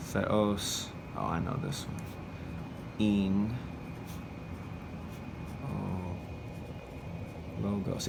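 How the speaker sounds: low, soft murmur with long pauses; student quietly working it out word by word; thinking to oneself. Shown as a demonstration. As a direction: mutter it to yourself as you write. Models student thinking while writing phrase.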